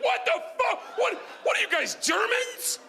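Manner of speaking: high-pitched comic yell; horrified disbelief. Retold or imitated. Dad reacting to the kids' trick, horrified mock yell. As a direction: cry out aghast, voice leaping high.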